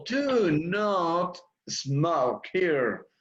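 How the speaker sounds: slow model line for learners; slow model reading. Shown as a demonstration. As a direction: Do not smoke here, very slow and clear. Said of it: "Se puede decir de las dos formas".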